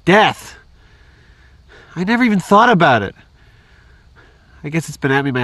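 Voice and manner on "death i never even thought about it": louder and higher, the words spilling out; overwhelmed outburst. Acted. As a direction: blurt out the fear that just hit home. Confessing fear of death to Mark.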